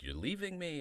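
hurt co-star. Retold or imitated. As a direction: ask it like a wounded old actor. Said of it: "So he was like".

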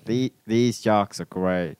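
slow, low imitation of Australian; tentative Australian, "great" kept close to "grate"; attempted Australian accent. Shown as a demonstration. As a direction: copy an Aussie accent carefully, word by word. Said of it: "I don't know how to talk like you guys".

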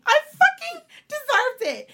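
high, loud, half-laughing wail; indignant outburst. Real, not acted. Woman venting about what her ex denied her, indignant wail. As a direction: cry out your grievance, stretching the last words. Venting over remembered hurt.

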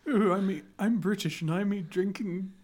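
badly faked, hammed-up British accent; failed British accent. Host mocking British tea drinkers, failed British accent. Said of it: "I can't even do a British accent".

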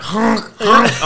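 high honking noise; duck honk imitation. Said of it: "you can just imagine this".

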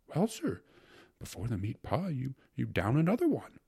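usual speaking voice

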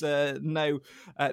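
normal talking voice

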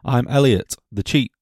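ordinary delivery

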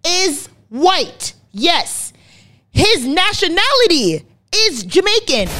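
high-pitched, insistent point-making; emphatic explaining. Real, not acted.

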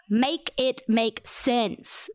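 each word of "Make it make sense" drawn out and separated; slow emphatic plea. Real, not acted. Podcaster demanding an explanation for the nominations, slow emphatic plea.

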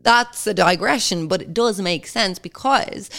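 deliberately annoying drawl. Real, not acted. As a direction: say it in an irritating put-on way. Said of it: "I don't know why I said that really annoyingly".

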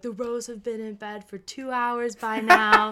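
mom's pointed comparison. Retold or imitated. Friend's mom urging her daughter to bed, lightly imitated pointed nagging. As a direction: imitate a mom holding up the neighbours as example.

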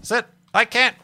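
high, loud frustrated yell; real exasperated gamer shout. Real, not acted. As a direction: yell it at the screen. Shouting at game.

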